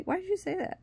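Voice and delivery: high, soft imitation of him; mimicked questioning. Boyfriend confronting her the next day, high soft mimicry.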